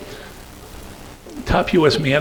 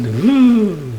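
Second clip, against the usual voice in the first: loud, high imitated engine "vroom"; revving motorcycle engine; 7 semitones higher, 8 dB louder than usual. Colleague answering the motorcycle question, loud engine noise. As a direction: rev up like a Honda motorcycle. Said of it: "Honda motorcycle make when you rev the engine".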